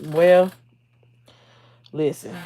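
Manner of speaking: usual speaking voice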